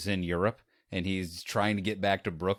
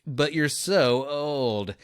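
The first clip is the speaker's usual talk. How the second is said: slow, higher drawn-out acted jab; mock bluntness; 5 semitones higher, 4 dB louder, much slower than usual. He provokes the supposedly British woman, mock bluntness. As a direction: stretch it out to needle her. Film line that makes her lose it.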